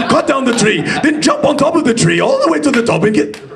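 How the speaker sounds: high-pitched, insistent mock shouting; shouting orders. Actor laying out an impossible list for the bear, shouting orders. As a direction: pile on the commands, pitched right up.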